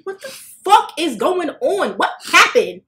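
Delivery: high, loud, slow demand for answers; alarmed shouting. Retold or imitated. Facing her boyfriend as he loses it, loud alarmed demand. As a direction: yell at him to explain.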